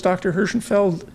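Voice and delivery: ordinary delivery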